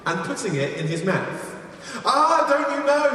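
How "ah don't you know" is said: high-pitched storyteller's reproach; mock admonishing. Villager warning a porcupine hunter, mock admonishing. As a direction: scold the rule-breaker in a raised pitch. Repeated warning acted in fable.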